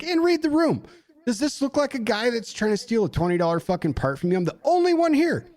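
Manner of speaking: high-pitched exasperated rant; hot with anger. Retold or imitated. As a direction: demand they see how absurd it is.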